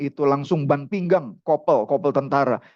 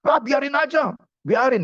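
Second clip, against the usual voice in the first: high-pitched angry dismissal; heated father, pitch raised; emotional outburst; 10 semitones higher than usual. Retold or imitated. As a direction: brush off the plea in acted anger. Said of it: "Ini karena papanya emosi".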